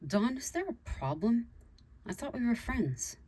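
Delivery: a touch hurt and defensive; feeling attacked. A narrator's reading. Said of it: "Abby felt attacked".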